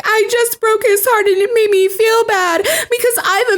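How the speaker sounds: high, laughing mock-tearful voice; mock Bella. Podcaster parodying Bella's tearful confession, mock crying. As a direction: ham up a teary confession. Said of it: "She's like".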